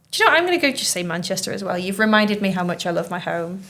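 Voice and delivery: ordinary talk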